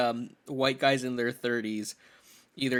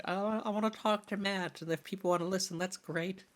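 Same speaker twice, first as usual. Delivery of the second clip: lightly put-on small voice, higher and soft; mock-meek, hushed and raised; modest little plea; 5 semitones higher, 7 dB quieter than usual. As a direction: say it softly, a little high. Voices his modest reason.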